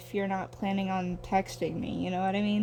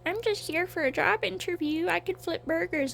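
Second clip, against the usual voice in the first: imitating the cartoon creature; 7 semitones higher than usual. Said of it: "the guy they were scared of was like".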